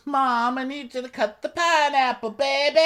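very high, sugary voice; high mom-voice impression. Kid asking mom, voiced very high. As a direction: coo it way up high. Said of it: "he goes".

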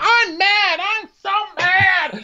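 very high, loud drawn-out wail; hammed-up weepy outburst; mock crying tantrum. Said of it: "So he walks off crying".

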